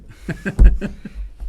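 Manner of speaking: a quick burst of laughter; short laugh. Real, not acted. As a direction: laugh at your own pun. Laughs at own number joke.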